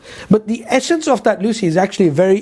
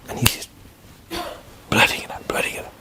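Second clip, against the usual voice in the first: whispered, trailing into near silence; hushed amazement; 9 dB quieter, faster than usual. Retold or imitated. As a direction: whisper it as if stunned. Awed retelling of child's answers.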